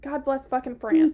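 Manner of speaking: loud mock exasperation; exasperated exclamation. Real, not acted. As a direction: blurt it out, fed up.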